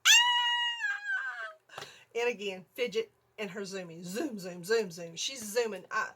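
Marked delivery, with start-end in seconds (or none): 0.0-1.8 very high, loud, drawn-out shriek; long piercing scream of mock terror; blood-curdling scream. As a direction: scream as if being murdered, re-enacted. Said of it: "I let out a blood curdle".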